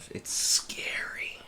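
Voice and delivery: slow, hushed mock-scary whisper; drawn-out spooky whisper. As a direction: whisper it slowly, playing up the dread. Spooky talk of the unknown future.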